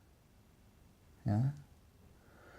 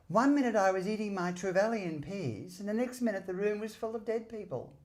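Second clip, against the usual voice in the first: much higher childlike mimicry; imitating Martin Bryant; 10 semitones higher than usual. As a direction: play him as a meek little boy. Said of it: "And that's how he spoke".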